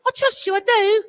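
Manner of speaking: a high mock British kid's voice; posh British child. Host mocking his child self trusting dad, British accent, high. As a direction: play a prim British child adoring his father. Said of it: "and you're British".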